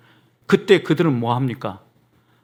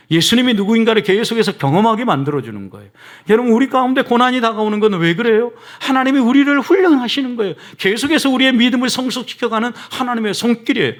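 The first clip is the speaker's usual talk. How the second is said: rising, louder preaching that builds to a peak; impassioned preaching; 6 semitones higher, 4 dB louder than usual. Acted. Pastor urging the congregation to see suffering as training, at full force. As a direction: preach it hard, pressing the point home.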